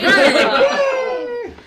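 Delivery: slow, laughing proud announcement; laughing drawn-out. Real, not acted. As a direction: stretch it out through a laugh. Proud own house, laughing.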